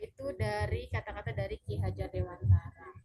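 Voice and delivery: ordinary talk, nothing marked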